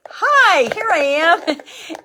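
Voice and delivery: high, loud hello into the camera; sudden cheery hi; bright greeting. Real, not acted. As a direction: pop in cheerfully. Pops into camera view.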